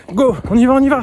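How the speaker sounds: high, loud rallying cry; pumped-up self-cheer. Real, not acted. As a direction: fire yourself up to push on. Cheering himself on mid-run.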